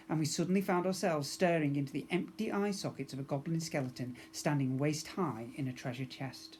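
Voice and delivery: ordinary delivery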